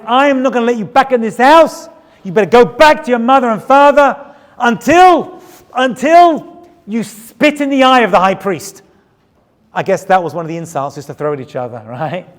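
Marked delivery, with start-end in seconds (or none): 0.0-8.8 higher, louder furious husband; angry husband. Retold or imitated. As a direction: throw her out of the house. Husband throws wife out, retold.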